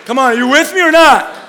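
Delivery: loud, high, shouted preacher hype; preaching at full force. Acted. Pastor rallying the church at full force. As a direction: fire the congregation up, voice climbing.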